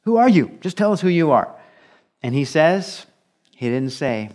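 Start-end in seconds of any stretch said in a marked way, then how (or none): 0.1-1.5 higher, louder acted demand; pressing questioner. Questioners pressing John the Baptist to name himself, insistent. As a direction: press for an answer, acting out impatient questioners.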